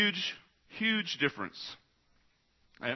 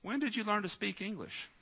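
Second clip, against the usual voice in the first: granddaughter's punchline; 9 semitones higher, 5 dB quieter than usual. Retold or imitated. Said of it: "She said".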